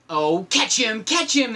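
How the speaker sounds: rising urgent cry. Acted. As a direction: call to grab the monkey. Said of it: "they cried".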